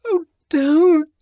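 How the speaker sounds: frightened woman crying out while being shaken; tremulous protest. Acted. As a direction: plead through the shaking. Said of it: "she protested in a voice made grotesquely tremulous".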